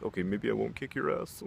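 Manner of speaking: imitating a cowed challenger, voice creeping upward; mock timid retreat in a put-on voice; meek back-down. Challenger backs down from big friend.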